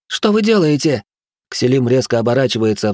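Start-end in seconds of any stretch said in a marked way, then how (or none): 0.1-1.0 high alarmed protest; alarmed shout. Acted. Zora protests.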